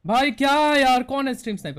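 high, loud outburst of protest; exasperated shout. Real, not acted. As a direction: cry out in frustration at being sniped. Exasperated at being sniped in game.

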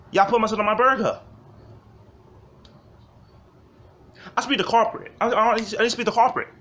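high, loud, fast whiny customer complaint; angry customer voice. Retold or imitated. Customer yelling at the cashier about mustard, high and whiny. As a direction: rant like an entitled diner over a burger.

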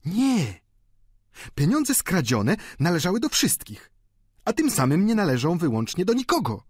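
high, insistent woman's voice; stanowcza stara panna. A narrator's reading. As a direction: argue the point firmly, pitched high. Michonneau przekonuje Poireta. (wysoki, stanowczy głos starej panny)

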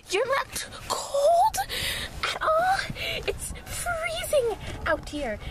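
high, slow, incredulous cry; shocked disbelief. Acted. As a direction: gasp it out in disbelief. Shocked at no jacket.

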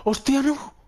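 high, startled exclamation; sudden shock, voice jumps up. Real, not acted. Shocked reaction to game.

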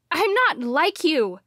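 loud, high protest; crying out. Acted. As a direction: cry it out firmly. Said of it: "I cried".

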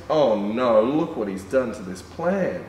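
usual speaking voice

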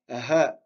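Ha exhaled breathily for repetition; a breathless puff on Ha; breathy ha. Shown as a demonstration. Teacher gives breathless Ha for class to repeat. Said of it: "Répétez après moi".

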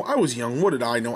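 usual speaking voice

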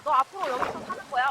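ordinary delivery

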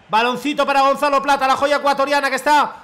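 steady live match narration; measured broadcast commentary; play-by-play commentary. Acted. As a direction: narrate the attack like a TV caster. Self-commentary as caster.

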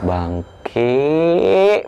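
stretched, rising mock-exasperated curse; drawn-out curse. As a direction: drag out the swear, pitch climbing. Retold cursing at a friend.

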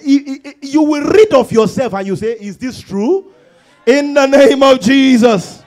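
loud prophetic declaration to the congregation; full-force preaching. Real, not acted.

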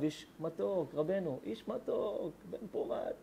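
soft, slow, fading to a whisper; hushed fondness. Real, not acted. Rabbi musing tenderly about the father, slow hushed whisper. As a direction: whisper it fondly to yourself.

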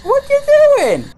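very high, loud squawk; high shriek. Acted. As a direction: shriek the question in alarm. Shrieking at the game.

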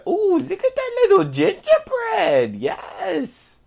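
very high, loud squeal of delight; excited squeal. Real, not acted. Gamer spotting a little gingerbread, excited squeal. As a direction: squeal over something tiny and cute.

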